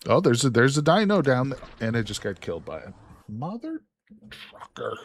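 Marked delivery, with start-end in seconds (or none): 2.0-5.0 softer, sneaky near-swear; hushed dropped curse. Real, not acted. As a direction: whisper the mother trucker. Censored curse over dino death.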